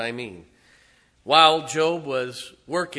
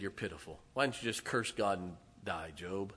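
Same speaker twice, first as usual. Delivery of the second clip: contemptuous wife; 6 semitones lower, 10 dB quieter than usual. Acted. Wife scorns suffering Job.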